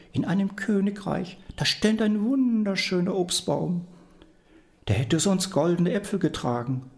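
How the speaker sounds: put-on high, sweet old woman telling a dream; grandmother voice. Grandmother pretends to tell the devil her dream, high and innocent. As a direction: innocently coax the answer out.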